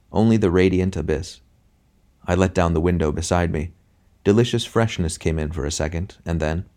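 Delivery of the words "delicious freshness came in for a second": plain reading voice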